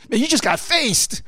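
mock-impressed reaction to a burn; imitated taunt. Mimics kids reacting to insult.

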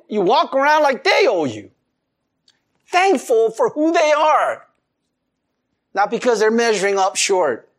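ordinary talk

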